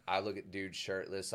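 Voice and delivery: ordinary talk, nothing marked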